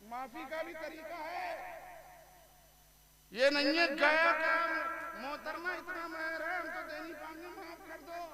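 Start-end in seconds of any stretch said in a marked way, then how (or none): 5.2-8.2 higher, softer mock plea to the bride; mock pleading groom. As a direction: ham up a meek groom begging off the dowry. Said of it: "ऐसे नहीं होता है". (दुल्हन से मज़ाकिया गिड़गिड़ाती अर्ज़)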